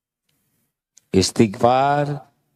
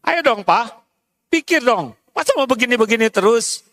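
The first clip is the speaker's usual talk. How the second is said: nagging wife; 9 semitones higher, faster than usual. Acted. Wife pleading with husband.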